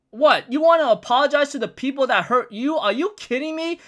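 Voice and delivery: a lightly voiced, hammed-up shocked rant; incredulous outburst. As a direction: act out a scolding of the one who was hurt. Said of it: "King Harold is like".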